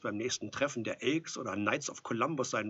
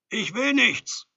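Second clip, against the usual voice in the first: high, drawn-out shout of refusal; calling out; 13 semitones higher, 13 dB louder, slower than usual. A narrator's reading. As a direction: yell it slowly through a closed door. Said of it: "die Augen geschlossen und rief".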